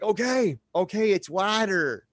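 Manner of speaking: loud, high "okay", then "water" as "wah-ter"; mock giving in with a piercing wide "wah-ter"; strident "water". Speaker mimicking mom's correction, strident "wah-ter". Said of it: "It's so strident".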